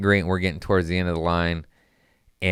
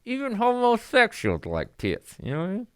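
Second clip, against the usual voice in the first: mock high voice; 10 semitones higher than usual.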